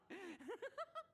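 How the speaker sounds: high, bright laugh of a knight mid-fight; hearty battle laugh. Acted. Young knight laughing at the ruin guard while fighting. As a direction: laugh off the enemy's missiles.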